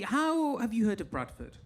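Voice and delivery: ordinary talk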